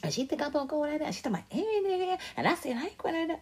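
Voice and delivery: high, quick chattering gossip voice; mom gossiping on the phone. Retold or imitated. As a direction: imitate mom running her mouth to her friends. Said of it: "That's how I got these voices, 'cause she be like".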